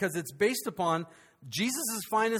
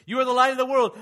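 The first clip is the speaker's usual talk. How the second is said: emphatic proclamation; 5 semitones higher, 8 dB louder, faster than usual. Acted.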